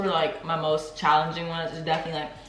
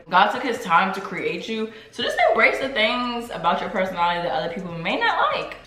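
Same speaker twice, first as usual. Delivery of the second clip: ordinary talk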